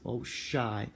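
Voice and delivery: ordinary talk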